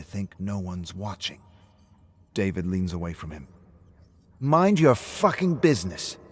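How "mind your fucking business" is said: loud, higher furious snap; angry snap. Acted. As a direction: bite his head off. Drunk David snaps at Rick.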